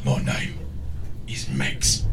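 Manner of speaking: gruff hero line. Retold or imitated. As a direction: growl it like the hero. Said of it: "all he says is".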